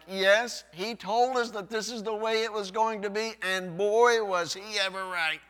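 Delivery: loud, high exclaiming; hammed-up amazement; mock excited agreement. Pastor voicing readers amazed the Lord was right. Voicing reaction to prophecy fulfilled.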